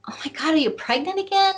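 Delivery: imitating mom, high and startled; shocked mother. Mom discovering her daughter's pregnancy, high shocked voice. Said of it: "she's like".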